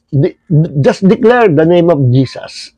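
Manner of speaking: ordinary talk, nothing marked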